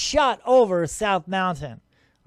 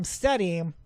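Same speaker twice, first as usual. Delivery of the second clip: ordinary talk, nothing marked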